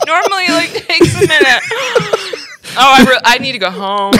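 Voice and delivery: high, laughing through the burn; gasping laugh at the heat; pained laughing. Real, not acted. Burning from hot sauce.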